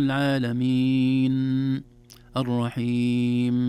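"العالمين" with the final n held far too long; wrong demo of a stretched nun; overlong n. Shown as a demonstration. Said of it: "أن نضغط على حرف النون زمن طويل".

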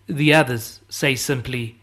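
ordinary delivery

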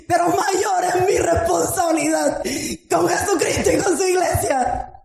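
full-force pulpit preaching; fiery preaching. Acted. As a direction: preach it out to the whole church.

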